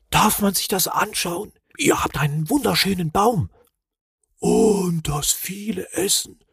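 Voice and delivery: high, small voice full of awe; reverent. A narrator's reading. Small relative admiring tree and food, reverent and high. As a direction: marvel in a high voice, slowing at the end. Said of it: "sagte einer der Verwandten andächtig".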